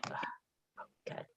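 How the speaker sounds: ordinary talk, nothing marked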